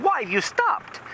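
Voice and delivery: high-pitched worried mother; imitated anxious woman, high voice; mock alarmed wife. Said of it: "she said".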